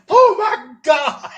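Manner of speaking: stunned high drawn-out gasp. Retold or imitated. Everybody staring in shock.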